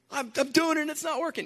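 fast, high-pitched exasperated plea; imitating his younger self's desperate complaint; frustrated disappointment. Retelling his complaint to a pastor, high and fast. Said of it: "it was that kind of disappointment".

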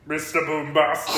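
comic reggae singer impression. Comic says what the charity record could have used, singer impression. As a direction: put on a dancehall star's catchphrase voice. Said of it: "a hint of [name]".

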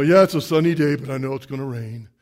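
gloomy Puddleglum. Retold or imitated. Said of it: "it's Puddleglum".

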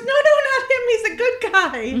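mock pleading. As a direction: squeal it like a frightened heroine. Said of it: "And she goes".

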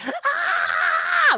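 high, loud fanboy scream; re-enacted shriek of excitement. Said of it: "And I was like".

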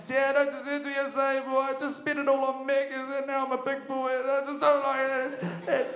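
high tearful plea with a half-laugh; hammed-up blubbering apology to dad; crying son. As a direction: beg through mock tears, voice pushed up high. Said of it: "the son starts crying".

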